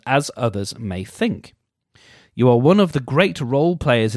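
mock-earnest reading; reading aloud. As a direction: read it like a horoscope entry.

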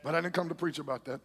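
usual speaking voice

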